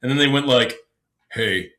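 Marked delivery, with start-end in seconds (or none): 1.3-1.7 a lower, gruff hey; a light parody of a bloke; mock man voice. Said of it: "my wife's way of, like, imitating men".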